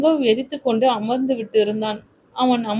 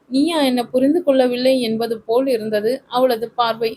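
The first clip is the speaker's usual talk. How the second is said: plain reading voice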